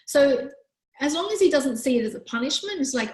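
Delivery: ordinary delivery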